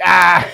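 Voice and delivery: a loud burst of steamy creature laughter. Acted. GM voicing the steam creatures' laughter, loud and hearty. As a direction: belt out a hearty group laugh. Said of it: "they're laughing with each other".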